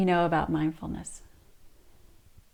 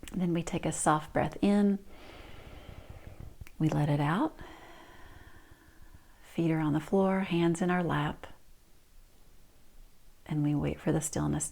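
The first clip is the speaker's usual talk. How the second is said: guided meditation; 2 semitones lower, 8 dB quieter than usual. Shown as a demonstration. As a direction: ease them into stillness. Walks through practice softly.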